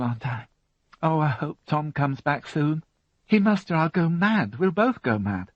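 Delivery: a hint of a fed-up teenager; teenage daughter voiced. A narrator's reading.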